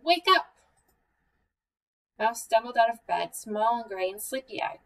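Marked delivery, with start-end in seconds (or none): 0.0-0.5 high, loud, urgent call; alarmed cry. A narrator's reading. Bear waking Mouse after hearing noises, urgent cry. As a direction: cry out to rouse a sleeper. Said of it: "he cried".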